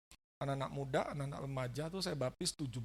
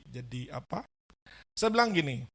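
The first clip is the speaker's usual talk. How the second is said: ordinary talk, nothing marked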